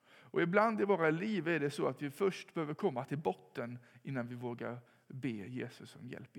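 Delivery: ordinary delivery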